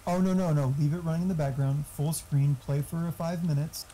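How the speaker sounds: ordinary delivery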